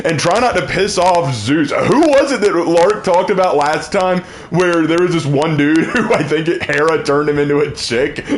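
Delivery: ordinary talk, nothing marked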